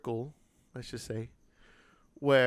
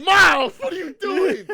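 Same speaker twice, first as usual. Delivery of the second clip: high, drawn-out laughing yell; exasperated shout; 14 dB louder, slower than usual. Retold or imitated. Son retelling how he called out his agreeing mom, exasperated. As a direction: act out yelling at mom in disbelief.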